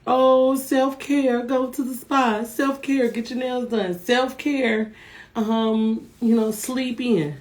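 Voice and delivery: mimicking self-care talk. Speaker imitates people forever talking about self-care, mimicking. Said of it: "tired of hearing people talk about".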